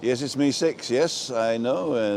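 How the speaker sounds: ordinary talk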